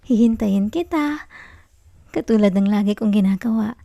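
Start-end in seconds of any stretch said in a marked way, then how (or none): none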